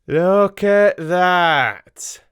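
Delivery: triumphant, stretched-out look at that. A narrator's reading. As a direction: savour the reveal like a winner. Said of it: "he said triumphantly".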